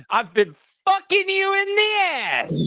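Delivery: high, drawn-out mock cry; hammed-up outburst. Crude joke blurted at co-host, hammed-up high cry.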